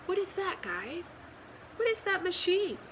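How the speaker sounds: high, fast, lightly alarmed; a touch of panic at the machine; alarmed cry. A narrator's reading. The small pig, spotting the vacuum machine, high, fast alarm. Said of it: "cries the small pig".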